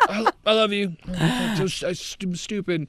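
high, faltering apology to mom; overwrought confession. Retold or imitated. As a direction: blurt it out in a high, stumbling voice. Tearful-style apology to mom while tripping.

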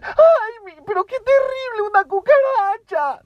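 very high, loud shriek of panic; mock hysterical scream at a cockroach; exaggerated hysteria. Shown as a demonstration. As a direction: squeal in hammed-up horror. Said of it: "estoy exagerándolo mucho".

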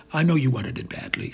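ordinary delivery

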